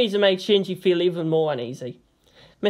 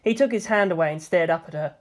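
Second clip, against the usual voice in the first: ordinary delivery, nothing marked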